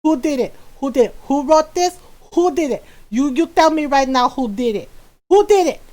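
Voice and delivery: lightly voiced scolding Filipino mom. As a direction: interrogate like a little Filipino mother. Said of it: "channeling my, my little Filipino mom".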